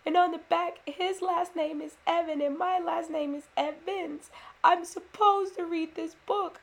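put-on high, loud, near-tears child's plea; tearful child pleading. Son pleading with mom for the bookstore book, high and tearful. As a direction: beg like a five-year-old close to crying. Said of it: "is now crying".

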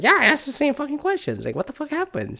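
a high, half-laughing what-the-hell; mock exasperated reply. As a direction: toss it off in a high, fed-up voice. Retells own exasperated reply.

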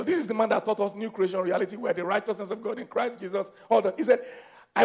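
ordinary talk

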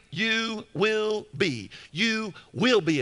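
"you will be" slow, each word set apart; emphatic stress. Shown as a demonstration. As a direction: lean hard on every word of "You will be". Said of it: "It's emphatic".

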